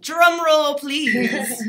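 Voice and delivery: building hype for the draw; excited call. Real, not acted. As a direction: call for a drum roll, eager. Host about to draw winner.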